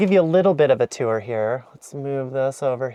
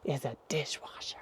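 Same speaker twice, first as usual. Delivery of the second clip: whispering; 16 dB quieter than usual. Real, not acted. Whispered reveal of dishwasher.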